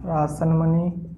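ordinary delivery

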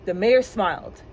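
plain reading voice

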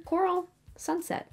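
"Coral Sunset" in a high, put-on American accent; terrible American accent. Shown as a demonstration. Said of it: "probably a terrible American accent".

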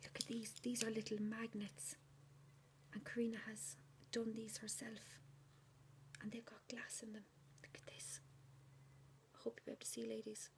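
soft, breathy admiring whisper; hushed whisper. Real, not acted. Showing the viewers Karina's magnets in a hushed whisper. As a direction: murmur in awe over something delicate.

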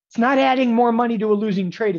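high, loud mock protest; defensive trader. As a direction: mimic a trader insisting defensively. Mimics trader defending a fix.

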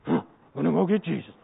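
low, slow brute voice; put-on low ogre voice; big ogre. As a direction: lumber along as an ogre on a mission. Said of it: "these big ogres".

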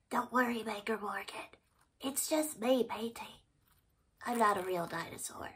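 soft gentle reassurance; calm her down quietly; reassuring. Real, not acted. Reassuring frightened Morgan softly.

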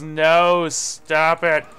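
loud, high, frustrated no; frantic yell. Real, not acted. Gamer yelling at enemy players, loud and frantic. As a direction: yell at the enemies to stop.

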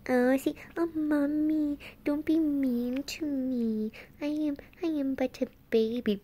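put-on lightly high, whiny little baby voice. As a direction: plead like a helpless baby. Voicing the dog as baby.

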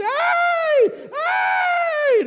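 high loud mock shriek; mock scream. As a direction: shriek like a startled woman at the movies. Woman screams in cinema.

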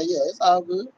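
ordinary talk, nothing marked

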